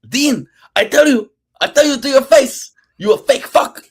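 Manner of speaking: put-on high, loud Cuban-accented rant; Hector Lombard impression. Podcast host mimics Hector Lombard accusing Dean, high loud accented rant. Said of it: "Perfect Hector Lombard impression".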